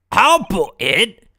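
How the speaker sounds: put-on loud booming Scottish accent; loud Scottish governor. Prison governor introducing himself to visitors, loud Scottish accent. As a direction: boom it out as a proud Scottish governor.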